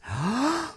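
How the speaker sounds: sharp high gasp, holding breath in; held gasp. Shown as a demonstration. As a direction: show a breath taken and held. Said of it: "deep breath of air and they can't exhale".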